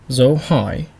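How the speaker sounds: hỏi dipping, then lifting; question-mark tone. Shown as a demonstration. Teacher naming the Vietnamese tones, hỏi with questioning bend. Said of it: "the question mark".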